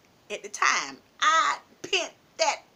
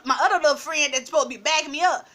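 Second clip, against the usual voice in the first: ordinary delivery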